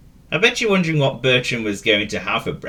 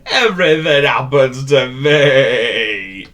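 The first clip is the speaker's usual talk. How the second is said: slow, loud, put-upon goat lament; self-pitying sigh; 3 semitones higher, 7 dB louder, much slower than usual. Acted. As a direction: moan at his own bad luck. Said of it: "he sighed".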